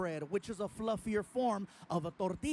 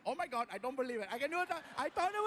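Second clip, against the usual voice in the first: put-on excited Indian accent; 3 semitones higher, much faster than usual. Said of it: "the guy was like".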